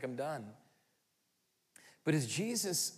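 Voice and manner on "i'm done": weary. Acted. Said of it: "He's like".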